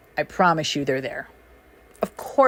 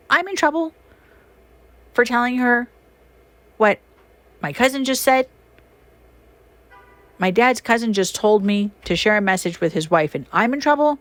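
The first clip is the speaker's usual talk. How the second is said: incredulous; 6 semitones higher than usual. Retold or imitated. Retelling being punished unfairly.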